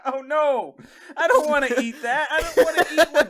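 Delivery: laughing through the words in mock horror; laughing protest. Real, not acted. Laughing in mock disgust at the host's joke. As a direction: laugh while refusing.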